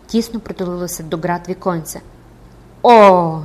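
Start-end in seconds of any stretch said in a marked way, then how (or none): 2.8-3.4 very loud drawn-out "oh"; frenzied cry. Acted. Recluse hearing her old name, frenzied cry. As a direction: burst out wildly. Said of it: "несамовито сміючись, закричала вона".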